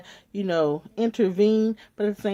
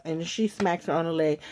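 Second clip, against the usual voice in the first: usual speaking voice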